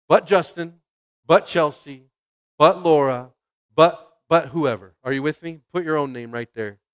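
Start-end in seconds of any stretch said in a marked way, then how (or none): 0.1-4.9 loud roll call of names. Shown as a demonstration. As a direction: call each name out to the room. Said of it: "you can put your own name right here".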